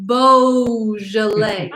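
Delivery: Beaujolais slowly, with a long "boh"; correct pronunciation. Shown as a demonstration. Host teaches the French pronunciation, slow careful Beaujolais. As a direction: draw out Beaujolais as boh-zhuh-lay. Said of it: "it is pronounced".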